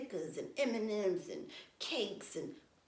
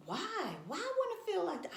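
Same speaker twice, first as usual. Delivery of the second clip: much higher and fast, indignant; incredulous why; 10 semitones higher than usual. Real, not acted. Vlogger scoffs at feeling winded, high-pitched and indignant. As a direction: fire off the question in disbelief.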